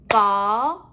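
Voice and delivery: VOLCANO in three long, separate syllables; a beat on each syllable of VOLCANO; clapped-out syllables. Shown as a demonstration. Teacher clapping out syllables for pupils, one beat at a time. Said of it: "We're going to clap out the syllables".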